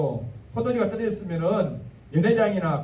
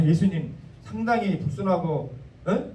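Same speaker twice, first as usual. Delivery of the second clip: normal talking voice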